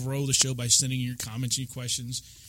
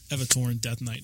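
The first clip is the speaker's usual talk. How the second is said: usual speaking voice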